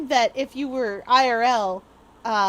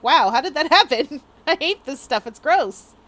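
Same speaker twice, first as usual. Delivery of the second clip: mock exasperation; 3 semitones higher, 8 dB louder than usual. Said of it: "you're like".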